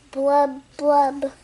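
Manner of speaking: ordinary delivery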